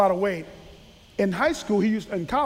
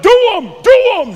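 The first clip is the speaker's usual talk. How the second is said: loud, high, fast hype shout; teammates hyping; 12 semitones higher, 15 dB louder, faster than usual. Retold or imitated. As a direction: yell it like pumped-up players egging him on. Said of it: "Guys are like".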